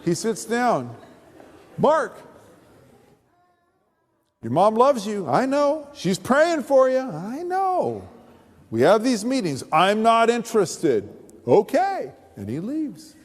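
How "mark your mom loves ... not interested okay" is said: high, sing-song replay of the weekly visit; lightly mocking both sides of the routine; mock pastor routine. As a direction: rattle off the same old exchange.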